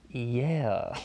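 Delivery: normal talking voice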